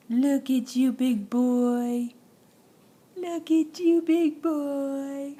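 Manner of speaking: loud, bright cheering; excited praise. Real, not acted. Cheering baby standing up.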